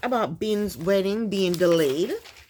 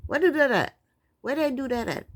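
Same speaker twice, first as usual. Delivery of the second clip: higher-pitched borrowed slang, tried out; imitated slang catchphrase; 5 semitones higher than usual. Vlogger trying out others' slang, higher imitated catchphrase. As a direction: try on a catchphrase that isn't yours. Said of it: "I don't talk like that".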